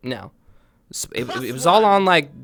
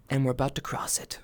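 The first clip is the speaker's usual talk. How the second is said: hushed ominous whisper; dramatic whisper; 4 semitones lower, 11 dB quieter, faster than usual. Acted. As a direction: drop to a conspiratorial whisper. Dramatic follow-up after shout.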